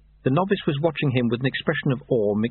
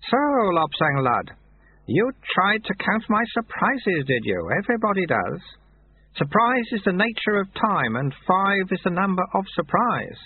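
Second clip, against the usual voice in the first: a hint of a gentle old sweeper, higher; lightly voiced old monk; 9 semitones higher than usual. A narrator's reading. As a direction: amiable old man reading a note. Lu Tse lectures slowly.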